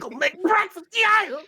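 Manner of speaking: high, loud happy yell; excited bugbear. Acted. Bugbear cook heads back to the kitchen, loud high cheer. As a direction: cheer as you head back. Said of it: "and he's like".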